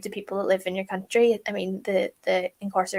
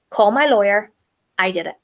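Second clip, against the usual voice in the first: firm command; 7 dB louder than usual. Retold or imitated. Mom takes the blame decisively.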